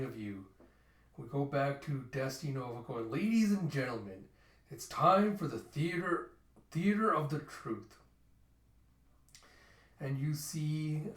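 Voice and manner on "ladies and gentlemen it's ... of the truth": grand announcer, higher and louder; showman's announcement. Acted. Host welcoming the audience to the theater, grand showman's announcement. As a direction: announce it slowly like a ringmaster.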